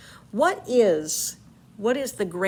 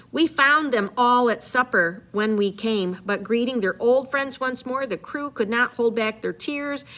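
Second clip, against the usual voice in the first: story narration, read aloud with energy; dramatic reading; 4 dB louder than usual. Acted. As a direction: read the epic aloud to the class. Teacher reads Odyssey aloud.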